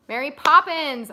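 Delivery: loud, drawn-out title call; loud announcement. Acted.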